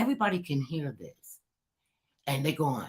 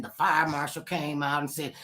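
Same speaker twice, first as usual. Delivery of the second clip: ordinary talk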